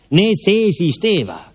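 ordinary delivery

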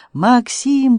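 high, drawn-out cry of a name; piercing cry, restrained. A narrator's reading. Woman sees her man stabbed, crying out high. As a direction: cry out high without full volume. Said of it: "пронзительно закричала Анжелика".

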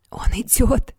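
high, shaky frightened whisper; trembling voice. A narrator's reading. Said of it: "дрожащим голосом проговорил Эрми".

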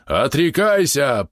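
проревел; roared. A narrator's reading. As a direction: bellow the command. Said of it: "проревел Ждан".

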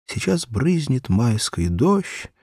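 usual speaking voice

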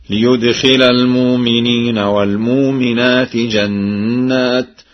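slow chant, "المؤمنين" as al-muumineen without hamza; hamza replaced by vowel. Shown as a demonstration. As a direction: recite "والمؤمنات" with a long uu for the hamza. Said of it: "أن ورشا يبدل الهمز الساكنة".

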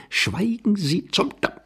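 high, suppressed outburst; exasperated hushing. Acted. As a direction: silence him with a high, stifled curse. Cuts off the other angrily. (gereizt, mit unterdrücktem Ärger)

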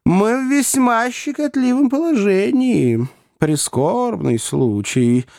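high, deliberate, anxious counsel; prim little gentleman urging compromise; fussy little lawyer. A narrator's reading.

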